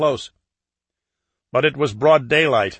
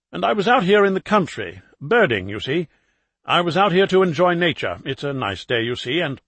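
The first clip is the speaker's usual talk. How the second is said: chatty put-on London accent, higher; 5 semitones higher than usual. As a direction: bluff the birdwatcher in London accent. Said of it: "in his best London accent".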